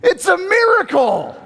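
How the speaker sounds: high, loud, astonished cry; hammed-up gasp of wonder; mock miracle shout. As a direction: blurt it out like an amazed onlooker. Mock crowd exclaiming at rescue.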